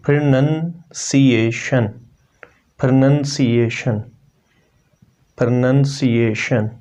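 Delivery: pronunciation said slowly, each syllable clear, three times; slow model pronunciation. Shown as a demonstration. Teacher slowly modelling the word for students. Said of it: "इसकी अपनी प्रोनंसिएशन क्या है?".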